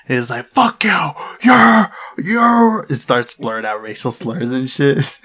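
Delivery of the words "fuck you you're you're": high, loud mock shout; imitated yelling. Storyteller imitates the man cursing at the family, mock yelling. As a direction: imitate a raving stranger's outburst. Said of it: "he starts yelling at us consistently".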